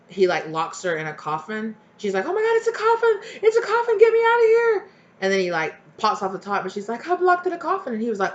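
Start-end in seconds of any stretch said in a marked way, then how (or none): 2.3-4.9 high, loud panicked wail; mock panic. Acts girl locked in coffin. 7.1-7.9 high-pitched acted-out alarm; mock distress. Acts girl complaining from coffin.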